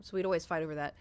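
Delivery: ordinary talk, nothing marked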